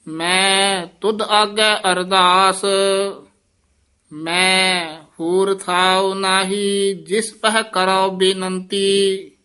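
slow, drawn-out chanted reading; slow devotional chant; Gurbani recitation. Acted. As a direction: intone each line like scripture. Chants the hymn slowly.

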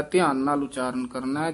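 ordinary delivery

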